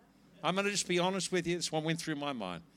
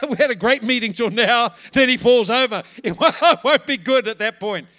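loud, higher, laughing through the words; 6 semitones higher, 15 dB louder than usual. Real, not acted. Preacher confessing his fear the man falls over, laughing loudly. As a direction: crack up at your own worst fear. Jokes about his fearful thought.

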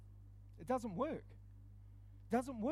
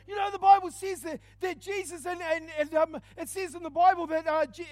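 higher and louder, a preacher's shout that stumbles and stalls; flustered mock preach; 9 semitones higher, 12 dB louder than usual. Preacher, copying Matty, shouts then fumbles the line. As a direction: start big, then lose the thread.

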